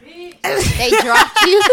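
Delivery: slow laughing disbelief. Real, not acted. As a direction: laugh through the question.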